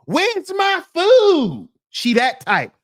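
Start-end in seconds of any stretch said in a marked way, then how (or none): 0.0-1.7 much higher, slow, loud hammed-up diva voice; hungry wife mocked yelling for her food; loud restaurant demand. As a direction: bellow it across the restaurant like an impatient diva. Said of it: "loud in the restaurant".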